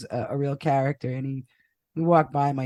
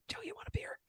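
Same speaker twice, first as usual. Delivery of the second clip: high airy whisper of the dad's line; whispered dad quote; 19 dB quieter, faster than usual. Retold or imitated. Retelling his dad's offer to a ghost in a whisper. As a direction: breathe it out as a joke.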